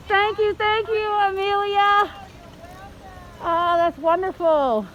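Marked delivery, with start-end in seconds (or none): none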